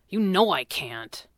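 slow, mildly sullen reply; dark. A narrator's reading. Brother refuses his sister's suggestion, darkly. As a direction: answer darkly, dragging the words a little. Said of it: "Jeremy said darkly".

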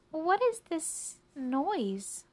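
high, slow and stretched question; bothered mouse. Acted. Woken mouse asks about the chirping, bothered.

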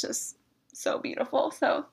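high, soft, tearful voice; choked up. Real, not acted. Speaker moved by her experience with God, choked up. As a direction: voice giving way with emotion. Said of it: "got me choked up".

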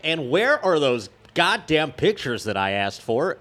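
imitating Casey Kasem's rant. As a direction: bark it out like an annoyed DJ. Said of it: "Where he's just like".

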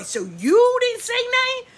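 high-pitched mock indignation; indignant accusation. Little girl silently accusing the grown-up who watched, high indignant question. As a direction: pitch it way up in outraged disbelief. Imagined child's indignant retort to adult.

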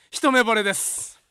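slow, loud declaration of love. Retold or imitated. Junior loudly declaring his crush near the counter. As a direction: drag it out and belt it. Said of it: "でっかい声で言い出して".

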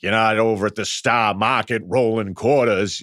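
slow, imitated Boston accent; bad Boston accent. Podcaster mocking Ham's line from the movie, bad Boston accent. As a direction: mimic an actor's clumsy Boston accent. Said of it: "you can't do the accent".

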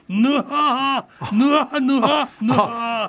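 cackling nuhaha laugh; a smug, chuckling old-man laugh; gloating laugh. Acted. Old man gloats over win.

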